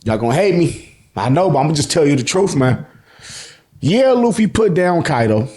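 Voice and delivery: ordinary talk, nothing marked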